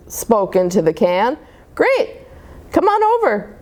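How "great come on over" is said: excited. Acted. Molly on the tin can phone.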